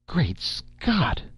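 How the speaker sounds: high-pitched cry of amazement; astonished exclamation. A narrator's reading. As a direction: exclaim it in acted shock. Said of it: "exclaimed Mister Medderbrook".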